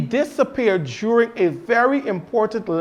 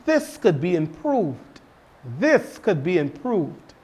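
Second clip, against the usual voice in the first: gentle tactful criticism; 5 dB quieter than usual. Shown as a demonstration. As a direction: suggest improvement gently. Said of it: "you can say something like".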